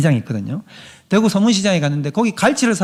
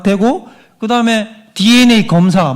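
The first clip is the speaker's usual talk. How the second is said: ordinary talk, nothing marked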